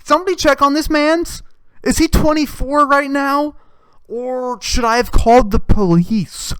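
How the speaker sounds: hammed-up alarmed bystander; mock alarm. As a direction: call out in pretend panic. Mock alarm at teacher's confession.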